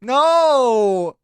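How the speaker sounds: long, high, loud wail; wailed protest. Pretend. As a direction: wail it in comic dread. Panics at being all alone.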